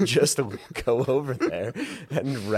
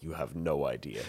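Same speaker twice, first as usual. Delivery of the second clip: ominous low voice; 10 semitones lower, 9 dB quieter than usual. Acted. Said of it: "I'm like".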